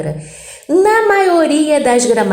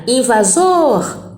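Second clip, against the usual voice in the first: "invasor" said slowly, with the stress landing on the final syllable "sor"; slow teaching demo of "invasor" with final-syllable stress; stressed last syllable; much slower than usual. Shown as a demonstration. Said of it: "Invasor tem que ser a última forte".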